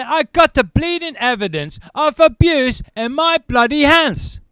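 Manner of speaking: loud, high, exasperated; father demanding social services act; indignant outburst. Retold or imitated. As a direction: push the evidence at them. Angry call to social services.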